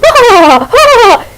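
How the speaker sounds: high squealing Noah cry; Noah impression. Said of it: "he sounded like Noah from".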